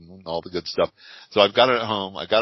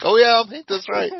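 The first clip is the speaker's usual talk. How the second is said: much higher and louder, hammed-up eagerness; mock eager reply; 14 semitones higher, 7 dB louder than usual. Painter answers the store owner in a high, loud, mock-eager squeal. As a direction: squeal it back like an overexcited fan. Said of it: "And I'm like".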